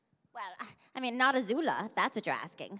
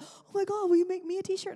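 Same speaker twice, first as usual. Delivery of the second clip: put-on excited kids; 13 semitones higher, 8 dB louder than usual. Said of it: "his friends were like".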